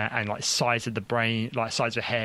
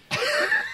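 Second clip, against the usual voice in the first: incredulous laugh; 4 dB louder than usual. Real, not acted. Laughing at the doctor's breeziness.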